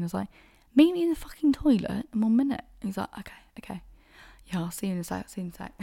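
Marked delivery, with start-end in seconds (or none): 0.7-2.6 loud, high, fast insistence; exasperated repeat. Retold or imitated. Repeating the toilet plan to her confused date, exasperated. 3.2-5.7 very soft, mumbled okay; hushed agreement. Retold or imitated. Date agreeing to meet her in the toilet, hushed. Date's sheepish agreement once he understands.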